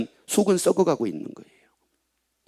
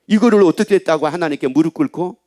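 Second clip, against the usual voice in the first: ordinary talk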